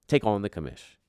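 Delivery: ordinary delivery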